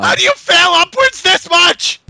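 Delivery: slow, incredulous outcry; exasperated rant. Real, not acted. Reviewer baffled by the failing company, exasperated rant. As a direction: drag the words out in outrage.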